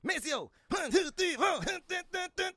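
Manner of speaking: high shouted [name] count-off. Retold or imitated. Imitating Eddie doing [name]'s count-in, high and shouted. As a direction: call the band in like [name]. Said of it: "and he does that".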